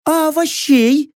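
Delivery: high-pitched questioning voice; voiced girl. A narrator's reading. Girl asks about vegetables for his meal, high questioning voice.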